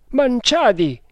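loud, high call to a friend outside; shouting a name. A narrator's reading. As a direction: call out his name in alarm. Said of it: "gridò il Maharatto".

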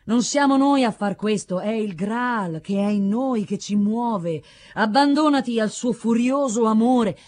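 slow, declaimed exhortation; ringing, drawn-out mystical authority; solemn admonishment. A narrator's reading. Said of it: "lo ammonì l'anziano".

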